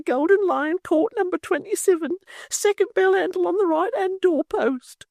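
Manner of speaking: a martyr bidding farewell, squeaking; Miggs, faint and fearful. Acted. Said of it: "more faintly than before".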